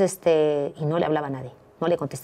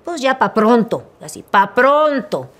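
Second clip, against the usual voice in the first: slow, loud, decisive; decided to get it over with; resolute; 5 semitones higher, 9 dB louder, slower than usual. Retold or imitated. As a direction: say it flat-out determined. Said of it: "Tienes razón".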